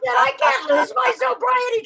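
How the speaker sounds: very high, loud hammed-up whine; panicked wail. Patient pleading with nurse about sobriety, high mock wail. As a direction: wail your protest, mocking your past self.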